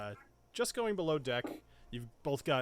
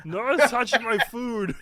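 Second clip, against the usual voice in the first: slow, louder, higher dopey lug; slow-witted cook; 9 semitones higher, 10 dB louder, slower than usual. Acted. Cook refuses the player's help, slow booming dim voice. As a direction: insist proudly like a big dim brute.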